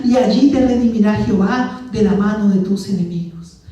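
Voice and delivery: loud prophetic reading, slowing on the key phrases; declaimed scripture reading. Acted. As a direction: thunder the prophecy to the church. Reads Micah aloud, declaiming.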